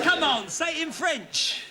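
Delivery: high, drawn-out exasperated order; exasperated prodding. Acted. Host urging a bungling contestant to speak French, exasperated. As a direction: coax slowly with rising impatience.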